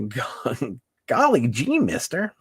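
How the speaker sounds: put-on high, chirpy aw-shucks kid voice; gosh-darn squeaky kid voice; wholesome 1950s kid. Jokey old-timey exclamation.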